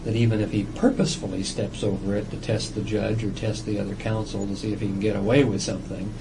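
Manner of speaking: ordinary delivery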